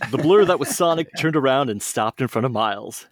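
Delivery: lively read-aloud storyteller voice; storybook narration. Acted. Friends on a podcast hear a fan story read aloud, lively storybook narration. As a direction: tell it like a bedtime story for kids.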